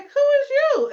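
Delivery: high, loud startled question; shocked confrontation; alarmed demand. Retold or imitated. Couple seeing two cats, high loud startled demand.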